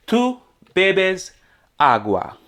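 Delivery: "Tú", "bebes", "agua" each set apart by pauses; word by word. Shown as a demonstration. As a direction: break it up for learners, a pause after each word. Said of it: "So let's translate a sentence".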